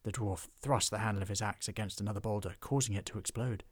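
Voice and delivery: usual speaking voice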